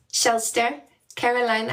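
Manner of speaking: ordinary talk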